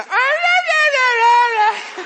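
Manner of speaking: very high, loud mechanical doll wail; hammed-up toy crying shout. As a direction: imitate a talking doll crying at night. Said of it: "el muñeco comienza".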